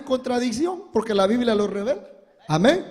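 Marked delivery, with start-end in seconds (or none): none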